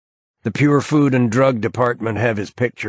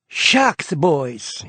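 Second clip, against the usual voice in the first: much higher, folksy aw-shucks Texan charm; disarming grin; 7 semitones higher than usual. A narrator's reading. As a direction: grin through it to win them over. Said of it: "he says with a disarming grin".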